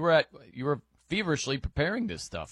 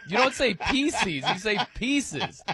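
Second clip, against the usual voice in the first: imitating his friend; 7 semitones higher, much slower than usual. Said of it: "He's like".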